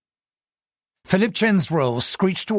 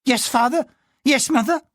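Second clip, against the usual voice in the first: higher, meek servants answering together; servants' chorus; 11 semitones higher than usual. Acted. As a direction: chant a meek reply. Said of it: "they chorused in Cantonese".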